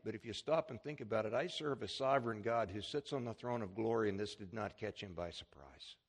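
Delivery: ordinary delivery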